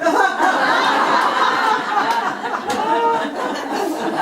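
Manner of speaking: high, loud burst of laughter; big laugh. Real, not acted. Telling the Bessie joke, a high, loud laugh. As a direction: crack up at the punchline.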